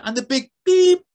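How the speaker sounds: ordinary delivery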